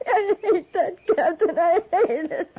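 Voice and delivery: high, choked sobbing words; breaking into tears. Acted. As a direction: let the voice crack and spill into tears. Widow breaks down before captain.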